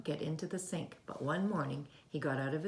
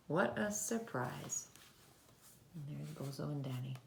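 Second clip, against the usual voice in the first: ordinary delivery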